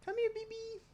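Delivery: high cooing call to a pet. Real, not acted. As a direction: coax the cat over sweetly. Calling the cat again.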